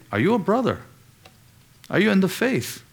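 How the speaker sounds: ordinary delivery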